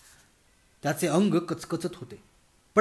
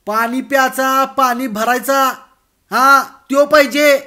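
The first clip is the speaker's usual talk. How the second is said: loud, slow, higher spelled-out shout; 8 semitones higher, 11 dB louder than usual. Acted. Bhagwan explaining to the deaf girl, slow loud shout. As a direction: shout each word slowly for the deaf. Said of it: "शेवटी मोठ्याने ओरडून तो म्हणाला".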